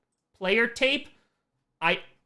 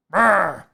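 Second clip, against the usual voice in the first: a quick rumbling grumble like a gruff coach; LSU coach impression; 6 dB louder than usual. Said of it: "That's what I imagine he sounds like".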